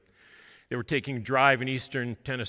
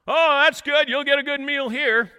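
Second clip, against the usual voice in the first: much louder and higher, hearty; loud booming man; 11 dB louder than usual. Retold or imitated. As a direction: boom it out cheerfully. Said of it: "he speaks very loudly".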